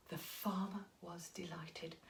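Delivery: hushed whisper. A narrator's reading. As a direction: breathe it out softly. Narrator drops to whisper.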